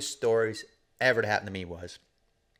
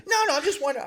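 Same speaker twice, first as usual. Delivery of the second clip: much higher, laughing mock apprentice; whiny young guy; much faster than usual. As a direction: whine it like a caught kid.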